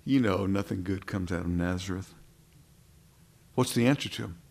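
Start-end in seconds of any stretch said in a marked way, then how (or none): 0.0-2.2 sceptical dismissal. Retold or imitated. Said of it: "shakes his head and says".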